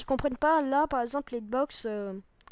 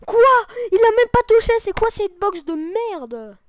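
loud, high outraged yell; outraged shout; 8 semitones higher, 13 dB louder than usual. Real, not acted. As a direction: shout it in disbelief. Unfair death in the game.

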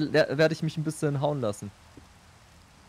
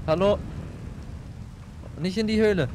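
rufend; 7 semitones higher than usual. Real, not acted. Ruft dem Partner hinterher.

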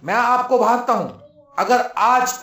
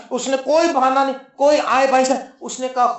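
ordinary talk

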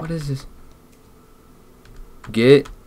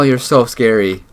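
ordinary talk, nothing marked

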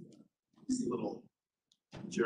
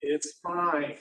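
high, louder, chirpy salesperson for the toilet; cheerful toilet pitch; 4 semitones higher, 6 dB louder, much slower than usual. Retold or imitated. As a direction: sell the toilet brightly. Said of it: "If I'm like".

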